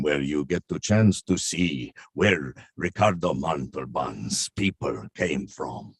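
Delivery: put-on slow, low Spanish accent. Touring Europe in accents for the host, Spanish accent. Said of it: "do the tour of Europe".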